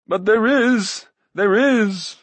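high, loud, drawn-out plea; whining high protest; crying Glue. A narrator's reading. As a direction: insist in a wailing voice. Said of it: "cried Glue".

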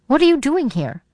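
high, urgent challenge; demanding. A narrator's reading. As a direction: confront an intruder. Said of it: "she demanded of Tony".

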